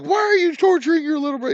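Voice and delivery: high, loud imitation of a scolding; mock yelling. Girlfriend scolding his brother over the phone, mock yelling. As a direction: retell her outraged yell, high and loud. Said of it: "I could hear her yelling at him".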